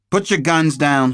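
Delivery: detective commanding armed agents, firm. A narrator's reading. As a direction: lay down the order firmly. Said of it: "she said firmly".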